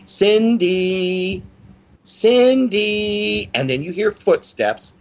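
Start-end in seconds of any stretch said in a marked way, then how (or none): 0.0-3.5 high, drawn-out singsong calling; little girl's lilting call, held long; sing-songy little girl. Retold or imitated. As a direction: call out a name like a child at play. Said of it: "in a little sing-songy voice".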